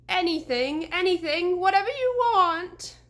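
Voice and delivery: high, loud frantic pleading; desperate plea. A narrator's reading. Scared deputy begging the horseman, high frantic plea. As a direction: gabble out anything to save yourself.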